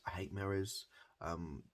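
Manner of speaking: ordinary talk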